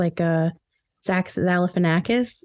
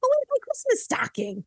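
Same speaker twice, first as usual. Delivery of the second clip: pitched way up but softer; high mock dismay; 5 dB quieter than usual. Host acting a guest missing her stocking, high mock dismay. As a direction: gasp it like a fussy guest.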